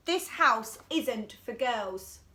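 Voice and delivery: drawn-out, each word weighted; boy guarding his house, slow and bossy; slow bossy refrain. Acted. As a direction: lay down the rule slowly.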